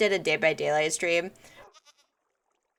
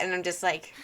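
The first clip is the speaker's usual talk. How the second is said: normal talking voice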